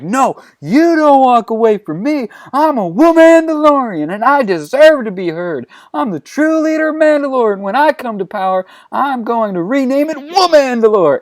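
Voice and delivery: high-pitched, hammed-up furious proclamation; outraged rant. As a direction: declare it high and forceful, refusing to be silenced. Acted outraged declaration.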